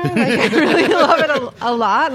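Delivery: loud, slow laughing gush. Real, not acted. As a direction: laugh through a gushing confession. Gushing over air conditioner.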